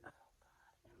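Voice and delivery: barely voiced groan of dismay; exasperated whisper. To herself while pestered by the men, exasperated whisper. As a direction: whisper it under your breath.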